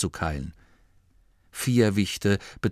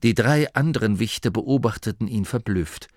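usual speaking voice

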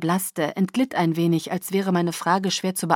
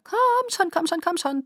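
very high singsong urging; trilling; 11 semitones higher than usual. A narrator's reading. Alice hurries Bella to the back door, trilling. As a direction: trill it as a little chant. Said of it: "trällerte sie".

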